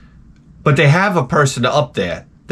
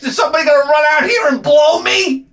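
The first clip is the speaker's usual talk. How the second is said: ranting shout; 13 semitones higher than usual. Real, not acted. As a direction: blow up at the absurdity.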